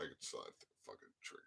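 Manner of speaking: fast, soft whispered admission; whispered aside. Real, not acted. Admitting to viewers he gets triggered, in a quick whisper. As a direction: whisper "trigger" under your breath, almost to yourself. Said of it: "yeah, I got triggered".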